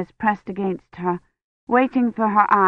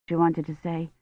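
ordinary delivery, nothing marked

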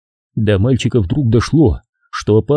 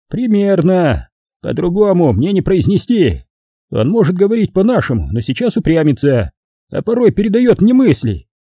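put-on old man voice; 7 semitones higher than usual. A narrator's reading. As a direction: speak like a patient old man. Old man Gas speaks.

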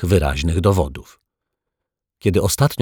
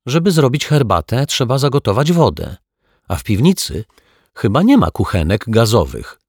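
put-on defensive girl; 5 semitones higher, 3 dB louder than usual. Child Asia argues, defensive.

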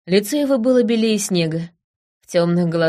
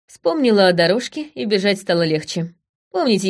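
usual speaking voice

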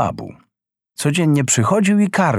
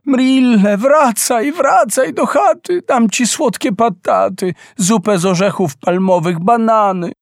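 much higher, desperate pleading cry; mother calling; 9 semitones higher than usual. Acted. Mother crying out to her son flying away, high pleading. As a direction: call after your son, begging him back. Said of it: "podniosła ręce wysoko i wołała".

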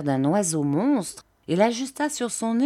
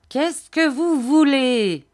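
put-on high, loud, drawn-out old man's demand; fierce cry; 8 semitones higher, 6 dB louder, slower than usual. A narrator's reading. Fierce old man confronts a frightened customer, high drawn-out cry. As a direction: cry it out ferociously, stretching the words. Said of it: "cria le vieillard d'un ton féroce".

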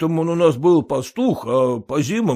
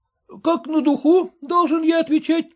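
lightly higher timid clerk's question; clerk asking warily; 4 dB louder than usual. A narrator's reading. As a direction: ask it carefully, not sure how honest to be. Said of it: "спросил приказчик".

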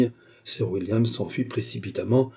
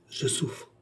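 soft, slightly raised murmur; pained murmur; 4 semitones higher, 8 dB quieter than usual. A narrator's reading. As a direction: murmur it softly, as if suffering. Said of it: "murmura le baronnet".

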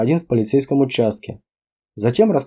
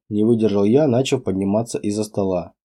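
ordinary talk, nothing marked

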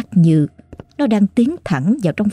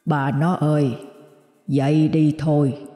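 ghostly grandfather calling; 4 dB quieter, slower than usual. A narrator's reading. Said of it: "lần này rất to và vang đều".

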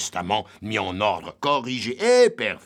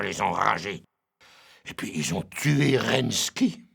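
normal talking voice

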